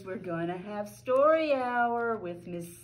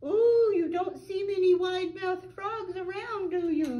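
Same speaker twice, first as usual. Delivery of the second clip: much higher, louder, squeezed through pursed lips; tiny puckered voice; 10 semitones higher, 4 dB louder than usual. Acted. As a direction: squeak it out with a tiny mouth. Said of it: "puckered his lips and made his mouth as small as possible".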